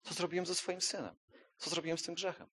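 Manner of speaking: hushed, gentle voice of God; softly, as God pointing to the cross; God speaking softly. Acted.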